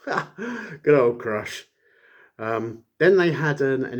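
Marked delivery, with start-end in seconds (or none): 0.1-1.7 slow, laughing fondness; a slow laughing aside; fond laugh. Real, not acted. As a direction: chuckle it out affectionately.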